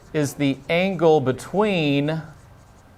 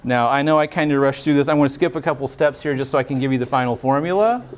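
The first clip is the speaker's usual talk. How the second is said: ordinary talk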